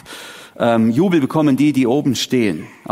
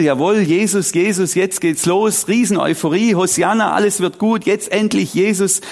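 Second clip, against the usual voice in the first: higher-pitched, acted-out crowd jubilation; euphoric jubilation; 6 semitones higher than usual. Preacher voices the crowd greeting Jesus, jubilant. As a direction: cheer like a crowd whose hero has finally come. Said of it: "jetzt herrscht da dieser riesige Jubel".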